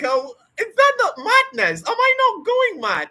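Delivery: high, loud mock panic; imagined learner fretting, high and loud; mock worried self-doubt. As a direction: squeak it out like a doubter fearing madness. Imagined listener's doubting quote.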